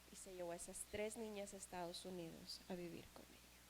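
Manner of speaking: hushed whisper closing the story. Real, not acted. Preacher whispers the ending to the congregation. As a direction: breathe it out, barely voiced. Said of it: "whispered narration".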